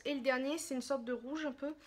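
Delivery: usual speaking voice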